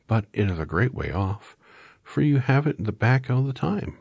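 very high, tiny squeak; squeaky mouse voice. A narrator's reading. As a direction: squeak like a little mouse queen. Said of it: "in her squeaky little voice".

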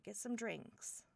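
ordinary talk

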